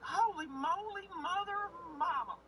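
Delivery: high, slow, awed fan voice; mock awestruck high voice; starstruck squeak. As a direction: gush like a dazzled fan. Starstruck self-quote, voice kept high.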